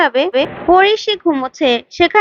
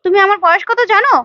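angry retort; 6 semitones higher, faster than usual. Retold or imitated. Retelling the serial, she bristles at the age jab, mock angry. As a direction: play the offended woman, voice jumping up. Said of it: "আরাধ্যা রেগে যায়".

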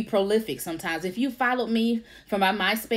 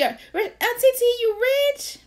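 put-on high, slow awed little-girl voice; lightly squeaky kid voice; child niece voice; 14 semitones higher, 5 dB louder than usual. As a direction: ask like an amazed ten-year-old. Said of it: "Now she".

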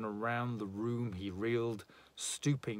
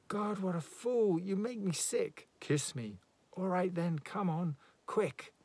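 exasperated; 8 semitones higher than usual. Acted. Exasperated woman.

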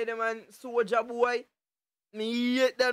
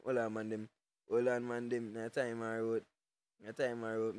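low and softer, almost to himself; muttered under breath; 11 semitones lower, 9 dB quieter than usual. Real, not acted. Streamer repeating the line to himself, low and soft. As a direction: drop it down low after the yell.

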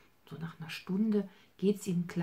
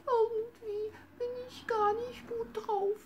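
sad little bunny. Acted. Bunny Hops tells grandma he feels sick, high crying whimper. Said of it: "so ganz traurig und sagte".

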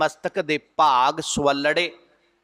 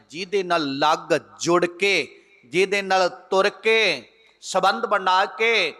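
usual speaking voice

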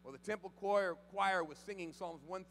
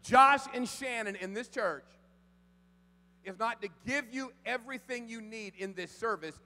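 loud, higher urgent exhortation; full-force preaching; 7 semitones higher, 13 dB louder than usual. Acted. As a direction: press it home hard.

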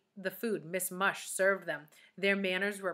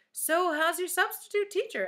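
high, louder, upbeat question; friendly questioner; 11 semitones higher, 5 dB louder than usual. A narrator's reading. Lewis to children on the playground, breezy asking. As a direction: call out the question brightly.

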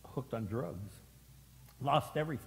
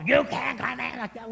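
loud, higher gatekeeper voice; mock stern refusal; 9 semitones higher, 6 dB louder than usual. Pastor mocking a judgmental usher, loud. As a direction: bark it like a bouncer. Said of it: "We don't get all".

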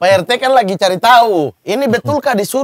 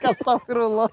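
shocked gasp of piety; 4 semitones higher, 6 dB quieter than usual. Acted. Shocked at the gossip.

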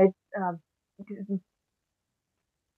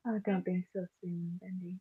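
seductive; 20 dB quieter than usual. Acted. Alice to Bendy, softly seductive. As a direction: tease seductively, far under full volume. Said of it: "if that's Alice being seductive".

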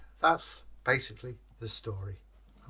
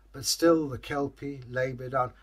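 plain reading voice

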